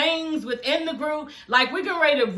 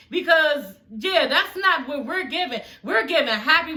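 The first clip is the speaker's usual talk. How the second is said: ordinary delivery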